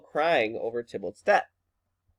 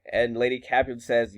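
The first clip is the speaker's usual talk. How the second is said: ordinary talk, nothing marked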